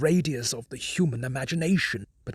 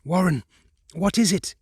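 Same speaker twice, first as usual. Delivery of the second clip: high, urgent frightened questioning; pleading for an answer in panic; terrified; 6 semitones higher, 3 dB louder than usual. Acted. Carter shouting down the telephone, terrified. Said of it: "Terrified, I continued to repeat".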